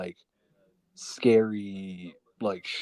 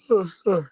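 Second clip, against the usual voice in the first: put-on shaky crying child breath; a breathy child's sob; holding back sobs; 8 semitones higher, 7 dB louder than usual. Shown as a demonstration. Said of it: "I was doing my crying child impression there".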